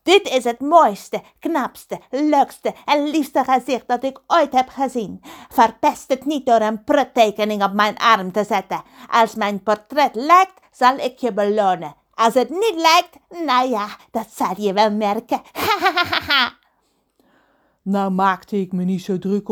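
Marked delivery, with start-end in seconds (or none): 0.0-15.4 vain, threatening pirate voice; hammed-up pompous pirate; Pedro de Wonderpiraat. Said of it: "vroeg hij en hij wees naar zijn gezicht". 15.5-16.5 high, hammed-up villain cackle; staccato evil ha-ha laugh; menacing pirate laugh. Pirate laughs after a threat.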